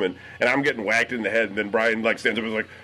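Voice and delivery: ordinary talk, nothing marked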